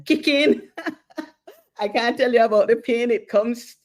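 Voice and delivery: bursting into a real laugh while talking fast. Real, not acted. Telling Sandra she survived, laughing.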